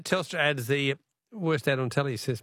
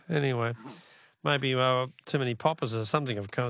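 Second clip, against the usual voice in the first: ordinary talk, nothing marked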